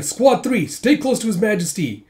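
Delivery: high, loud imitated officer; shouted order. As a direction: call orders across a battlefield. Retold officer calling orders.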